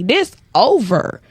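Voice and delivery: ordinary talk, nothing marked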